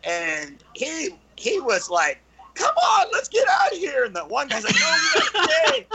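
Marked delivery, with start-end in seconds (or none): 2.5-4.0 very high mock-panic cry; panicked football player. Big football player urging friends to flee, mock panic. 4.8-5.9 high, fast mock voice through laughter; arguing kid. Kid arguing with fleeing friend, mock-panicked squeak.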